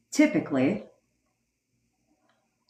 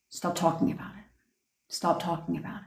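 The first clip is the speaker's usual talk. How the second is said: whispered repeat, very soft; 10 dB quieter, faster than usual. Real, not acted. Whispering to viewers to stop talking. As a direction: hush it out as a command.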